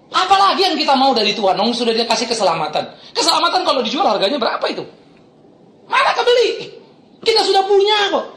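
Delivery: rhetorical questions climbing high in pitch; impassioned preaching. Real, not acted. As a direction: challenge the congregation, pitch leaping up at the end. Preaches with rising force. (khotbah retoris berapi-api)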